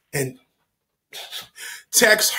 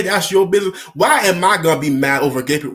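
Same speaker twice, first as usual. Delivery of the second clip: ordinary delivery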